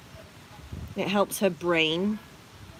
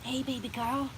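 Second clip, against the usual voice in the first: cooing; 6 semitones higher, 5 dB quieter than usual. Real, not acted. Owner cooing softly to her bunny. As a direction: coo to the rabbit.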